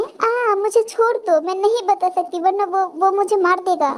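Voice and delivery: high, pained begging voice; frightened pleading. Acted. Captive with twisted finger begs boss, frightened.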